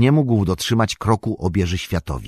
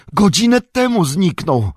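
much higher panicked yell; 11 semitones higher, 4 dB louder than usual. A narrator's reading. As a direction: shriek it out in alarm. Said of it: "wrzasnął Sam". (przerażony wrzask, dużo wyżej)

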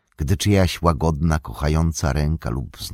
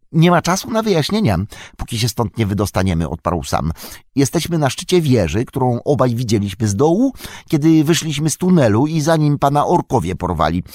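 higher, louder, hurried hobbit voice; urgent Sam; 8 semitones higher, 4 dB louder than usual. A narrator's reading. Sam urging Frodo to escape the tower, urgent hurry. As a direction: rush it, no time to lose.

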